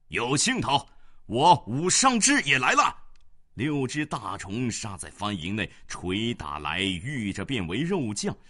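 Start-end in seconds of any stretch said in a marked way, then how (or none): 0.1-3.0 high, loud exultant shout; loud battle cry. A narrator's reading. General joining the fight, shouting boldly. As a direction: shout with relish as you join. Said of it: "叫声".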